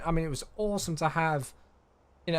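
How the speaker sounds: ordinary talk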